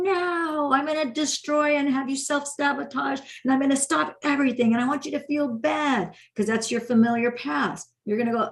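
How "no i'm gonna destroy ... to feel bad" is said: high-pitched mock scream without real volume; screaming ego. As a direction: act out a protesting ego, voice pitched up. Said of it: "So it's screaming, going".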